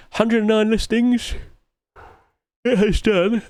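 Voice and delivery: sleepy, late-night talk mid-yawn; talking through a yawn. Real, not acted. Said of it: "Sorry, I'm yawning again".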